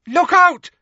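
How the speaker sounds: loud, very high alarmed shout; horrified warning. A narrator's reading. Neville spotting danger behind his friend, horrified shout.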